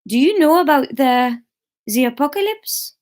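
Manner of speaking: put-on comic French-accented question. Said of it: "we'll go French".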